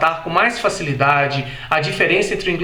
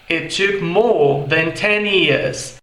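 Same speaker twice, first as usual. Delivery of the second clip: slow British "more" with no R; British English, no R in "more"; British accent; much slower than usual. Shown as a demonstration. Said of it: "Em inglês britânico". (inglês britânico lento, sem R)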